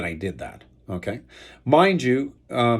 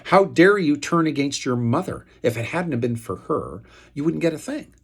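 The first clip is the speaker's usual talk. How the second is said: higher, louder mock accusation; retelling the lawyer's phone call in a raised mock-accusing voice; imitated accusing tone; 6 semitones higher, 4 dB louder than usual. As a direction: throw the lawyer's accusation back at him. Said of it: "in an accusational tone".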